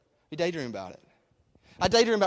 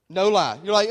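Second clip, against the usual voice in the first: normal talking voice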